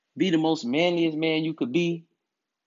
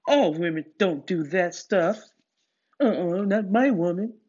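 lightly mocking voice of protesting men; a hint of a mock objector; objecting men; 3 semitones higher than usual. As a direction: mimic the naysayers, pitched up a little. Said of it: "if you're not agreeing with what I say".

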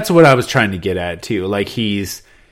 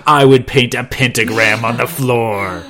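mock outrage; 6 dB louder, slower than usual. Joking satanist reply, laughing.

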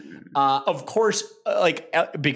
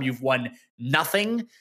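normal talking voice

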